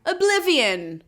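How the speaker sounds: high, loud ferryman's call for passengers. Retold or imitated. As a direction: hawk the ride like a bored ferryman. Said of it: "Charon ... is like".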